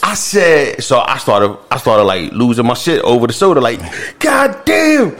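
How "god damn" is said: God damn yelled high like Chris Rock. Retold or imitated. Man shocked by the bill, yelling like Chris Rock. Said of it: "Oh, you was like Chris Rock".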